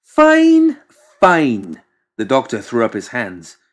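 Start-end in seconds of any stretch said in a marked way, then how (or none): 0.0-1.8 high, loud huffy surrender; huffy giving in. Acted. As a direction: give up in a sulk. McMorning giving in.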